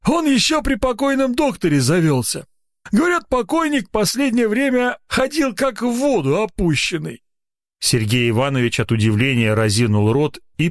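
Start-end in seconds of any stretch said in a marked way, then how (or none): 0.0-7.2 put-on high, mumbling old watchman through bare gums; toothless old man mumbling. A narrator's reading. Watchman gossiping to the doctor in a mumbling toothless voice. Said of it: "смешно шамкая беззубым ртом".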